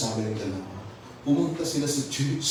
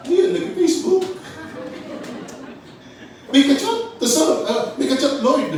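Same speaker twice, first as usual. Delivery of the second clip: much higher, louder, gleeful; excited tattling; 13 semitones higher, 5 dB louder than usual. Retold or imitated. Satan gleefully exposing the pastor chatting online. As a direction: squeal it like catching someone out.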